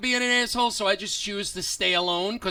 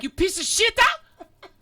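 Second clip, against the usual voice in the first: much higher, loud, slowed-down mock yell; imitated screaming passenger; 11 semitones higher, 4 dB louder, slower than usual. Angry woman yelling at the bus driver, hammed-up screaming. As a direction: stretch every shita into a shrill screech. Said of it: "And she's like".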